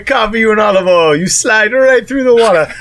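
mock Italian.

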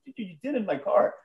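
high-pitched retold grievance; exaggerated, indignant whine in retelling; mock complaint. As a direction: play up the wounded car owner. Acted complaint to the cyclist.

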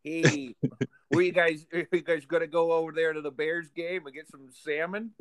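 put-on Chicago sports-fan accent; jokey Chicago dolphin accent. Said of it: "a Chicago-style accent".

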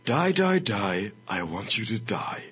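hammed-up sinister letter reading; mock-threatening, half-whispered, with long pauses; menacing hate-mail writer. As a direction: read it slowly as a creepy ill-wisher, sinking into a whisper. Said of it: "I have a letter for you".

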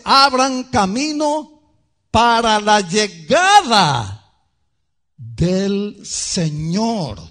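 loud, high, drawn-out proclamation; herald crying out. Acted. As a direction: cry it slowly as a herald, easing at the end. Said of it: "soy esa voz".

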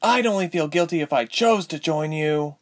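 high, biting retort; spitting defiance. A narrator's reading. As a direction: spit the words back. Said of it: "Ilse spat".

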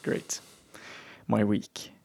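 usual speaking voice

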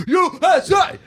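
high-pitched chanting cheer; patriotic cheer. Pretend. Celebrating a baseball win to co-hosts, cheering. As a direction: burst into a fan's chant.

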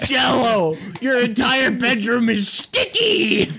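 high slow exasperated scold; mock scolding mom.

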